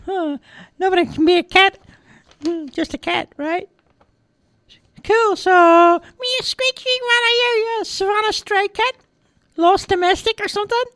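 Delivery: very high squeaky voice; high-pitched hesitant cartoon mouse; eager little mouse. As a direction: fawn over the cat as a curious mouse. Mice question the cat.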